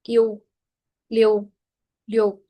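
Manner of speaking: "Liu" with the I-U glide rounded toward a closed O, like lio; teacher modelling "Liu" slowly; pron demo. Shown as a demonstration. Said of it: "Se convierte en un I-U".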